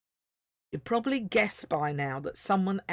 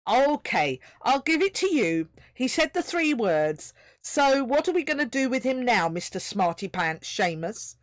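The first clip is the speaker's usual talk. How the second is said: higher, louder grudging sarcasm; sarcastic voice; 6 semitones higher, 5 dB louder than usual. A narrator's reading. Second voice mocks Seamus: sarcastic. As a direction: needle your smug friend. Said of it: "the second sarcastic voice".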